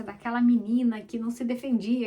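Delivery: ordinary talk, nothing marked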